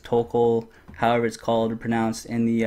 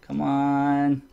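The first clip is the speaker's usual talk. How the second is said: ordinary delivery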